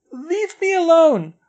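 high, loud, slow tearful cry; a crying girl shouting through a door; tearful outburst. A narrator's reading. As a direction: push her away through tears. Crying girl in locked stall.

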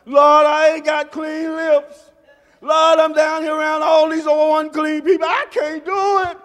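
Isaiah crying out he can't go on; desperate Isaiah. Acted. Isaiah's plea voiced, rising.

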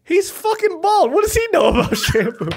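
lightly voiced indignant teenager laughing; imitating teen. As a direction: ham up an outraged British teen. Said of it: "He's like".

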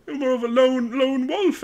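high, softening showman voice; theatrical rabbit shopkeeper. Acted. Shopkeeper puzzles over Fugless having companions, high showy voice.

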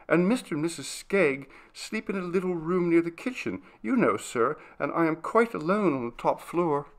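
put-on lonely young maid explaining herself; timid servant girl, confiding.

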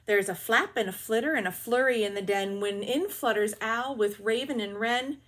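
usual speaking voice

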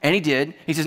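ordinary talk, nothing marked

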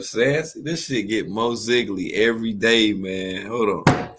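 usual speaking voice

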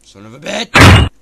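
loud furious curse; angry yell. Real, not acted. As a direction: shout it out in rage. Yelling after failing.

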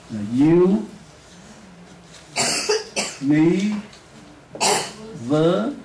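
each syllable said separately with long pauses. Shown as a demonstration. Teacher splitting university into syllables. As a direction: break the word into syllables. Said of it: "Đọc với tôi được".

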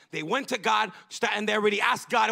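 ordinary talk

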